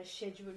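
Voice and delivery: "schedule" begun with a soft SH sound; British SH at the start of "schedule"; British pronunciation. Shown as a demonstration. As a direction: say "schedule" as SHED-jool. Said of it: "supposed to sound in this accent".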